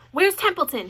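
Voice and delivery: high, loud demand. A narrator's reading. Wilbur demanding to know where the rat is, loud. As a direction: demand it urgently. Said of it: "he demanded".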